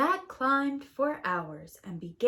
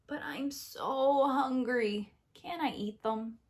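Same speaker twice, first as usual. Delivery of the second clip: slow whine; whiny hungry Jack; 3 semitones higher than usual. Acted. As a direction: plead for food. Jack voiced in story.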